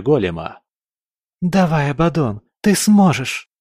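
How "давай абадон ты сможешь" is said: ordinary delivery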